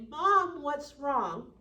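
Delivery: put-on adult child, alarmed, calling out to crying mom; worried child. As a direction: ask mom what's wrong, alarmed. Visitor asks crying mom.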